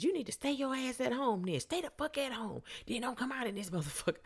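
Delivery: high-pitched mock scolding; riled-up man. Drunk man ordering woman to stay home, mock-riled. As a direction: imitate his worked-up telling-off. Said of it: "he's getting all riled up".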